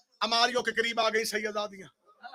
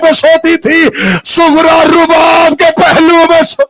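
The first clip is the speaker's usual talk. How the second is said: louder, higher wailing recitation of grief; rising majlis lament; 6 semitones higher, 20 dB louder than usual. Acted. Preacher mourning Sughra before the congregation, loud wailing. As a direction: build the lament to a full cry.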